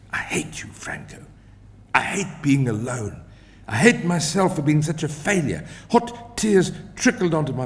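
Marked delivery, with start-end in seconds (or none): 0.1-3.2 breathy whisper of bitter longing; whispered heartbreak. Acted. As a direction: whisper it like a confession into the pillow. Lonely heartbroken outburst after dream.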